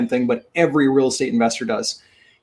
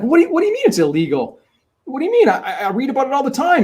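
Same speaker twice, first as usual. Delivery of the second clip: incredulous; 10 semitones higher, faster than usual. Retold or imitated. Nate retorts, shocked at closer.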